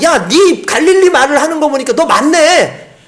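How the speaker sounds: loud, high and slow gotcha; triumphant accuser. Retold or imitated. Bystanders catch Peter by his Galilean speech, loud and slow. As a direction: nail him down. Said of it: "이 사람들이".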